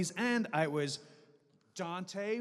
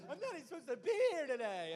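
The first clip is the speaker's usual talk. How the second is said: high, fast whiny complaint; whiny high Dante voice; 11 semitones higher, 7 dB quieter, faster than usual. Retold or imitated. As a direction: whine it up high and quick. Dante's catchphrase imitated.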